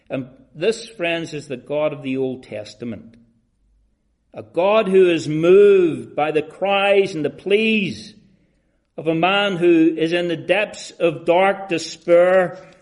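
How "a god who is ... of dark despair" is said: high, loud pulpit cry; impassioned preaching. Real, not acted. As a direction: preach it with rising fervour, leaning on the key words. Preaches at full force.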